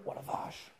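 hushed startled whisper; whispered gasp. Real, not acted. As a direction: breathe it out in surprise. Startled in dark kitchen.

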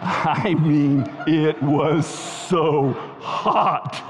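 extremely slow, every word stretched; milk the punchline word by word; drawn-out emphasis. Retold or imitated. Preacher justifies his mother's swearing, drawn-out comic emphasis.

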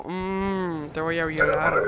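lightly voiced creepy hum and threat; mock a leering man; a hint of a creep; creepy stare voice. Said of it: "me había quedado mirando como".

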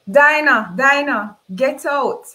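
loud, high-pitched order to leave; hysterical shout. Acted. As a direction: shout her name twice and throw her out. Acted shouting to throw Dinah out.

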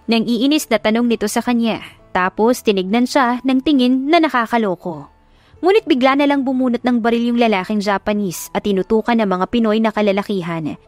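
usual speaking voice

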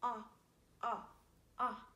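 "Ah" as the short O of octopus; short O sound. Shown as a demonstration. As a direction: sound out "Ah, ah, ah" in three short separate vowels.

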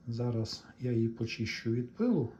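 ordinary delivery

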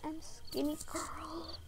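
slow whispered joke; whispered aside. Pretend. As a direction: whisper it slowly like a secret.

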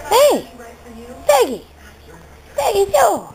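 high calls to the dog; calling out. Real, not acted. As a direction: call her name up high to bring her over.

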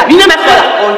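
high, loud angry yell; hammed-up jealous shout; furious outburst. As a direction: explode at the cheating boyfriend.

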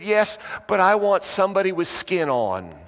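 put-on high, insistent childlike voice; child's plea. Girl answering her parents, pleading for company.

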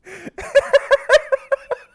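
high-pitched burst of real laughter; can't hold it in, squeal with laughter; helpless high laugh. Real, not acted. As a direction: streamer breaks down laughing mid-list. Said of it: "That caught me off guard".

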